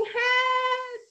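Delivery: high, stretched distress call; sustained high-pitched mock cry for help; shouting for help. A narrator's reading. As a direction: call out to the farmers in pretend panic. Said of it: "again he started shouting".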